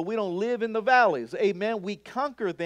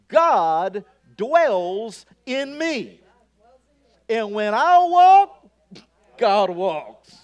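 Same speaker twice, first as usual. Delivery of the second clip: bold declaration; 3 semitones higher, 10 dB louder, much slower than usual. Shown as a demonstration. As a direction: declare it boldly to the congregation. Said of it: "Be bold about it".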